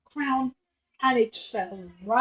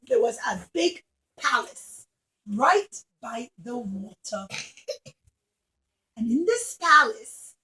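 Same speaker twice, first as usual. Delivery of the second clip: usual speaking voice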